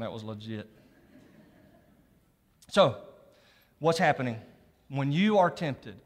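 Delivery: usual speaking voice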